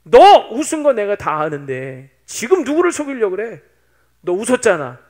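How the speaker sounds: loud, angry cross-examination building to a shout; scolding at full voice; God's fearsome confrontation. Acted. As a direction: call out the lie, furious. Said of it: "무섭게 따지시는 거예요".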